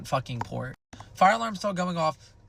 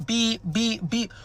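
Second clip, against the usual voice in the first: high, repeated alarm beeps; imitated fire alarm; 7 semitones higher, slower than usual. Storyteller mimics her kitchen fire alarm, high beeping. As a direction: mimic a smoke alarm going off. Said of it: "my fire alarm is now starting to go off".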